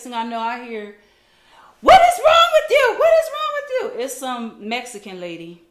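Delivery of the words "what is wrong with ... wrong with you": very high, loud screech of outrage; imitated yelling. Stranger comes over to confront the mother, imitated yelling. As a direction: mimic a woman shouting as she storms in. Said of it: "she yelling".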